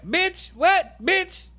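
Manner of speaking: high-pitched what-did-you-say; shocked outburst. Real, not acted. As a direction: squeal it in outrage. Reacting to the gossip.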